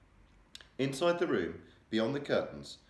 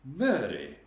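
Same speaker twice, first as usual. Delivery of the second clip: sharp; 5 semitones higher, 6 dB louder than usual. A narrator's reading. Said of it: "rather sharply".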